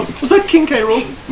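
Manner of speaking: high, disbelieving ask; surprised question. Real, not acted. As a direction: squeak it out in surprise.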